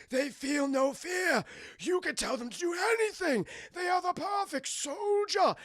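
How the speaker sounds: high, stretched villain boasting; the Doctor. Retold or imitated. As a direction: crow grandly like an overacting mad scientist. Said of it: "he talks like this throughout the entire movie".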